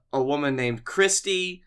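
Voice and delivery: usual speaking voice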